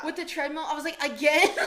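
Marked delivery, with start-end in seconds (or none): 1.0-1.5 loud, laughing cry of disbelief; exasperated yell. Retold or imitated. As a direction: shout it, fed up but laughing. Treadmill lost after the bike.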